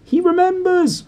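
he's been remembered, squeal with glee; thrilled high cry. Acted. Diggle to the crowd, thrilled high squeal. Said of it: "cried Daedalus Diggle".

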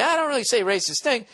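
high-pitched mock protest; put-on mocking reading. Shown as a demonstration. Host rereading the emailer's line another way, mocking. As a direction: squeal it in a jokey put-on voice. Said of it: "Or are you saying, like".

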